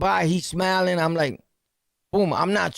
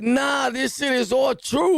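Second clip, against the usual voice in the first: amazed exclaim; 6 semitones higher than usual. Retold or imitated. Realizing stories were true.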